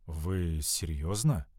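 slow, low, doubtful question; disbelieving. A narrator's reading. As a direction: ask it as if you can't believe it. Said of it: "с недоверием спросил он".

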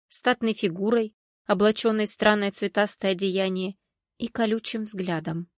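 ordinary delivery

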